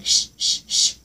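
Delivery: sharp whispered shh; mock shushing. Imitating a classmate hushing her friend, whispered shh. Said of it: "starts, like, saying".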